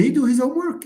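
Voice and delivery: high-pitched mocking echo of a mistake; mocking recast. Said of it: "He can make fun of you".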